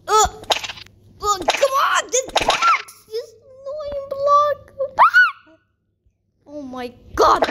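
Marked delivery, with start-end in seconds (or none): 1.4-4.7 high, strained exasperated shout; frustrated shouting. Real, not acted. Player fighting an annoying block, frustrated shouting. 5.0-5.6 very high, loud shriek; frustrated shriek. Real, not acted. Player beaten by the block, frustrated shriek.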